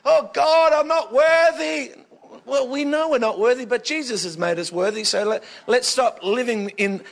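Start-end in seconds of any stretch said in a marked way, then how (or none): 0.0-1.9 high, loud, drawn-out grovelling; exaggerated wail of self-abasement; mock begging. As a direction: plead like an unworthy crawler, hammed up. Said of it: "beg or crawl or scrape".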